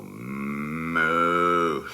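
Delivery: long, drawn-out moo; imitated cow moo. Demonstrates a cow noise himself.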